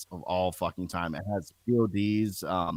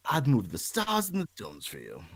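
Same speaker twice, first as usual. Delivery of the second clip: Bowie-style line; 5 semitones higher than usual. Retold or imitated. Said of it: "like a [name]".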